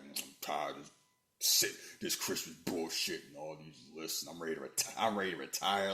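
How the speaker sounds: low, soft imitation of a weary grouch; grouchy straight man. Imitating a movie star tired of Christmas, low grouchy deadpan. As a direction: lightly play the fed-up straight man. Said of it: "Rock playing Mister Straight Man".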